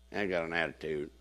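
low, quiet sulky mimicry; mock attitude. Preacher mimicking someone with a negative attitude, low mock sulk. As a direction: play a sulk under your voice.

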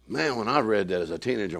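usual speaking voice

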